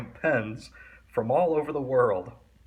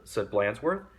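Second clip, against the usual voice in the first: usual speaking voice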